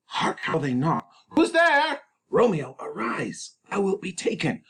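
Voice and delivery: high, loud, urgent friar hurrying Romeo. Acted. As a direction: hurry him, someone's at the door. Friar's urgent lines, read high.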